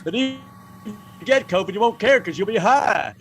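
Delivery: put-on presidential pitchman; hammed-up politician impression in a commercial; Bill Clinton. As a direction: sell it like a folksy politician's ad. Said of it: "Then he could have done his own commercials".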